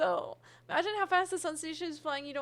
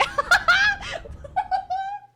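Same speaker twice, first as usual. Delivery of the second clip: a long, high, real giggle; high giggle fit; 9 dB louder than usual. Real, not acted. Streamer giggles at a chat message, high giggle.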